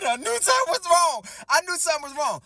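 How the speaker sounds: high, loud cry of vindication; high-pitched indignant yell; outraged outburst. Retold or imitated. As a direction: burst out high and loud, then ease slightly. Reacting to news of cheating.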